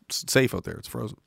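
usual speaking voice

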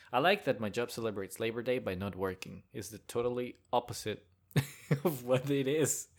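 ordinary talk

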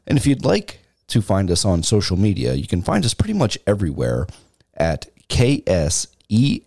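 slow, spelled-out sign-off read; podcast outro. Acted. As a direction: read the plugs like an announcer.

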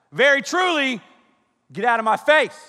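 loud, high mock outburst; hammed-up angry confrontation; mad at somebody. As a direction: tell them off at full voice. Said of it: "when you're mad at somebody".

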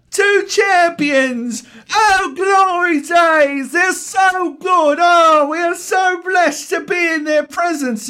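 very high, slow, shouted mock child; gushing little kid. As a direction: squeal like an over-awed kid fan. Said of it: "then the little kid's like".

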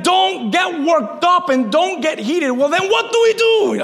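building to a high, heated question; getting worked up. Real, not acted. Preacher heating up over David's advice, worked up. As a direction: get carried away, pitch climbing to the end. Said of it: "I'm getting worked up".

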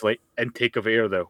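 ordinary talk, nothing marked

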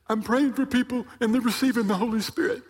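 high-pitched mock tearful voice; imitated crying. Elder reporting to the preacher, mock crying. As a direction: imitate a man wailing through tears. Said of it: "and he's crying".

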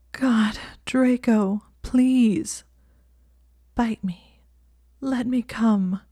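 high, slow, desperate plea; pleading. Acted. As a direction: beg him, drawing out every word. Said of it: "she said in a pleading tone".

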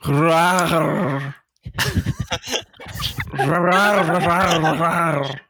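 rolling cartoon cat growl; cartoon cat roar. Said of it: "in your patented cartoon cat voice".

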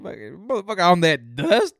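high, loud mock outrage, rising shriller as it goes; mocking girlfriend. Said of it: "my girlfriend was like".

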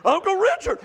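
high, wondering exclamation; amazed. Retold or imitated. Pastor retelling his amazement at Richard, high and wondering. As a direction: marvel at what he just did. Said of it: "I'm like".

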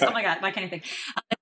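laughing at herself. Real, not acted.

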